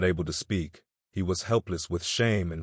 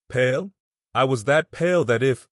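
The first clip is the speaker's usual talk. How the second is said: higher and louder echo of Pale; indignant echo; 5 semitones higher, 4 dB louder than usual. Acted. Woman echoing her friend's remark, surprised and emphatic. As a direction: seize on the word and exaggerate.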